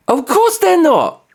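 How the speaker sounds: high, slow sarcastic scoff; mock scoff. Real, not acted. Ranting vlogger mocking the idea, high sarcastic scoff.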